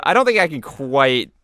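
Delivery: usual speaking voice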